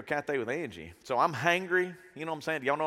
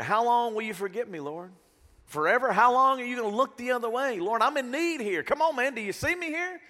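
raised-pitch, exasperated plea; pleading complaint; 8 semitones higher than usual. Acted. Broken man questioning the Lord, exasperated plea. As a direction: build higher as you demand to be seen. Voices scripture as frustrated plea.

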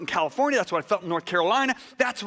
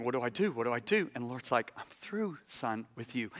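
low, much softer retold dialogue; hushed exchange; 8 semitones lower, 10 dB quieter than usual. Preacher recalling asking the Lord what to do, hushed. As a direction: drop right down, nearly under the breath.

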